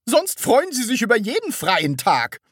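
high, loud, baffled objection; uncomprehending. A narrator's reading. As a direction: protest as if it makes no sense. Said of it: "sagte Frau Rotkohl verständnislos". (verständnislos)

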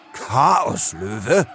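questioning, rising at the end; incredulous echo. A narrator's reading. Leo gasps at the crocodile's insult, incredulous. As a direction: repeat the insult as a baffled question.

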